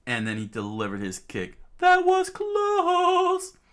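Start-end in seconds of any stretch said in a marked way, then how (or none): 1.8-3.6 very high, slow drawn-out relief; high relieved cry. Acted. Fighter relieved after a close call, high slow squeak. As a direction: stretch it out in a squeaky voice.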